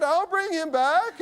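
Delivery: put-on high, drawn-out old lady call; older woman. Older woman to anxious father, high drawn-out reassurance. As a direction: sing it back slowly in a high old lady's voice.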